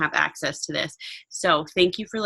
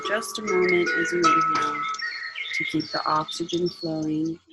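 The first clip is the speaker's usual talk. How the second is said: guided meditation; 4 dB quieter than usual. Acted.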